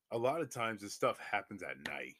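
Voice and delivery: normal talking voice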